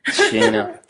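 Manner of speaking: put-on "Shayna" repeated in an English accent through a laugh. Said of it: "that's my English accent".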